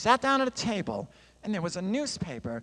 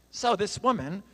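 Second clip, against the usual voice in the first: ordinary talk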